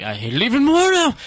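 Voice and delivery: high, loud mock rant; mock angry outburst. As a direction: blurt it like a fed-up critic. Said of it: "being like".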